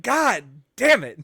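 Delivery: loud drawn-out groan of frustration; slow, loud exasperated curse. Retold or imitated. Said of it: "and I'm like".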